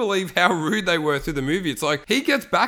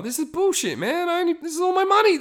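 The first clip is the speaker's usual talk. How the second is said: much higher, fast whiny protest; outraged Charlie; 13 semitones higher, faster than usual. Retold or imitated. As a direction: squeal it like a kid robbed of his coin.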